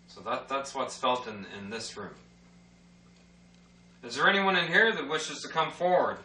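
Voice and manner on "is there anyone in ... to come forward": raised voice calling out; talking to a ghost. Real, not acted. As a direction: call out into the empty room. Calling out to spirits.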